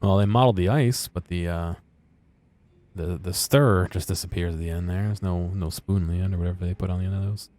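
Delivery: ordinary delivery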